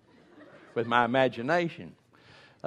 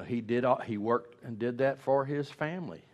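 normal talking voice

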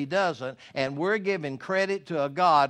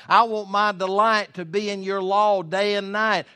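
louder, higher, pleading with the Lord at full force; fervent preaching prayer; 5 semitones higher, 8 dB louder than usual. Acted. Preacher voicing a prayer to the Lord, fervent and loud. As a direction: cry out to God from the pulpit. Impassioned spoken prayer to God.

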